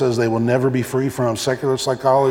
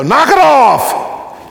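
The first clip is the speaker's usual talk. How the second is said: sharp, slow, high parental shout; drawn-out high parent yell at the kids; 9 semitones higher, 8 dB louder, much slower than usual. Acted. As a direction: bark it at kids bouncing in the back seat. Parent scolding kids in car.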